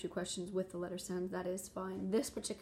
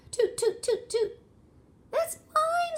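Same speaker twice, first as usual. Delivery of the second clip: very high, loud tooting calls and claim; lizard voice; 8 dB louder, slower than usual. Acted. Lizard claiming the egg in the story, high chirping. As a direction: chirp it like a tiny proud lizard. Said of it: "says the lizard".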